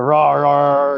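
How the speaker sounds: loud barked rah, rah; hammed-up drill sergeant bark; mock drill sergeant yelling. Describing his ranting persona, mock yelling. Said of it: "if I were a drill sergeant, this is what I'd sound like".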